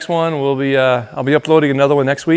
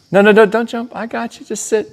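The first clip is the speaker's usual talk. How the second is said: high urgent calming of a dog; talking to a dog; 10 semitones higher than usual. Real, not acted. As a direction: keep the dog from jumping. Dog about to jump.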